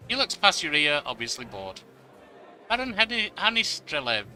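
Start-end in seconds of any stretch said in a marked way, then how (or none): 2.7-4.3 "Baron" hit higher and harder than the name; stress on Baron. Acted. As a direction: lean on "Baron", then drop for "Hanis Drelev". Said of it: "emphasizing the word Baron".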